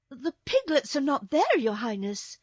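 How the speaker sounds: agitated maid. A narrator's reading. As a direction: raise the voice as a troubled maid. Maid reports the loss to princess.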